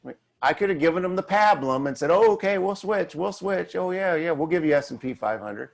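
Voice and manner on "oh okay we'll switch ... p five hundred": higher-pitched, eager-to-appease mock agreement; mock placating. Advisor caving to clients who want a switch, mock placating. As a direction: give in with a quick, mocking yeah-yeah. Acted pandering reply to clients.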